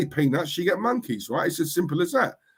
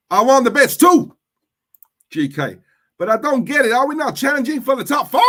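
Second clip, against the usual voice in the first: loud, high mock American accent; a hammed-up Yankee voice, shouting; 9 semitones higher, 8 dB louder than usual. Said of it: "how is my American accent".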